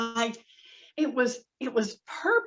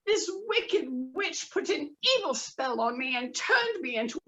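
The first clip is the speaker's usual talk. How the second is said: higher pleading fish voice; pleading magic fish; 6 semitones higher than usual. Acted. Magic fish begging the fisherman, high pleading. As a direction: beg for your life, higher. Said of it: "And he said".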